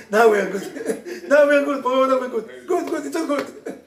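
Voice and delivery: real giggling relief as the tension breaks; laughing relief. Real, not acted. Rabbi laughing with relief after the couple reconciles.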